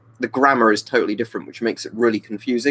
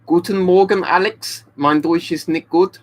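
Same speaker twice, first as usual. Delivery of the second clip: higher-pitched amateur German accent; high, careful German with a put-on accent; 8 semitones higher, 3 dB louder, slower than usual. Real, not acted. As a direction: greet in beginner's German, putting on the accent. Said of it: "you kind of want to do an accent".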